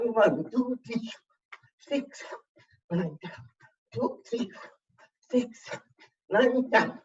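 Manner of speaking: high, punchy counting of each rep; workout count. Acted. Fitness coach counting reps for the class, high and punchy. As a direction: call out the reps to keep the class moving.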